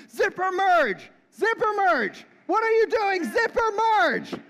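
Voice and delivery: loud, high-pitched yelling at another driver; exasperated shout from behind the wheel; road-rage yell. Acted. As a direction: yell it out, stretching the words.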